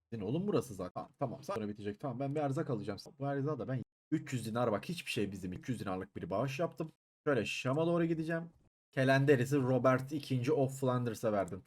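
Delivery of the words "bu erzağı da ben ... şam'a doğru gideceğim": ordinary delivery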